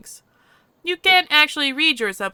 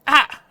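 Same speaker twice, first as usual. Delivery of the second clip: sharp louder gasp of pain; 5 semitones higher, 6 dB louder than usual. Acted. Touched near the wound, a pained gasp. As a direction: flinch out a short cry. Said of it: "I flinch".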